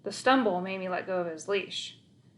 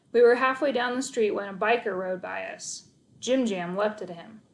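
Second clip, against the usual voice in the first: ordinary delivery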